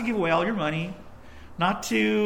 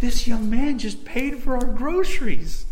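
high, loud, slow astonished wail; overwhelmed wife; 6 semitones higher, 8 dB louder, slower than usual. Retold or imitated. Shaken wife to husband about the stranger, overwhelmed exclamation. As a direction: exclaim it high and drawn out, overcome.

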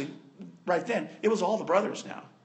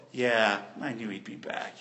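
indifferent shrug; 11 semitones lower, 5 dB quieter than usual. Retold or imitated. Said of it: "didn't just sit there and go".

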